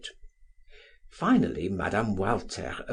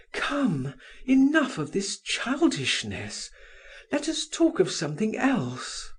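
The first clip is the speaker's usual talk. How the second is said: gasped; 10 semitones higher than usual. A narrator's reading. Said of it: "she gasped".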